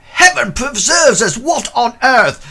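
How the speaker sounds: put-on old guard aghast at the jackal-masked body; horrified old porter. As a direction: cry out as if the sky fell. Porter Bert horrified by zombie.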